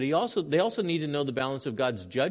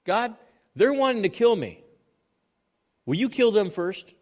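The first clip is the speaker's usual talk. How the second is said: loud, high, angry prayer to God; angry plea; 8 semitones higher, 6 dB louder than usual. Acted. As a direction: cry out to God in anger. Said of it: "Notice what Jeremiah says".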